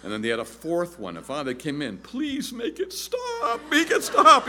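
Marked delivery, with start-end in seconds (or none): 2.0-4.5 high, slow, crying plea of worn-out parents; mock begging parents. Preacher imitating parents pleading to stop having babies, high crying wail.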